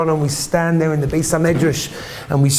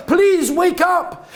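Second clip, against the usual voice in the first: high, drawn-out shouted plea; desperate cry; 13 semitones higher, much slower than usual. Acted. Desperate parents calling to unconscious son, shouted plea. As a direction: cry it out slowly, desperate for him to hear.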